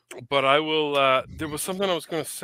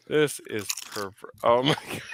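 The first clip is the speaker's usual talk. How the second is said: usual speaking voice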